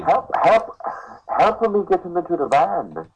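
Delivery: put-on high, thin Japanese accent. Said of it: "do your best Japanese accent".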